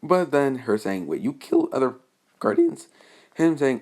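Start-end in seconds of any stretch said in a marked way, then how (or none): 1.1-2.9 a startled squeak of disbelief; sudden shocked disbelief; shocked question. Retold or imitated. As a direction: pitch leaps way up on Guardians. Shocked question in retold scene.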